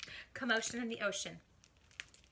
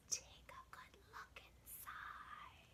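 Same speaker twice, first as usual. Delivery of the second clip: high, soft, slow menacing whisper; creepy slow invitation; sly shark whisper; 12 semitones higher, 17 dB quieter, slower than usual. Acted. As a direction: lure them in with a hiss.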